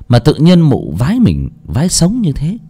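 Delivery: ordinary delivery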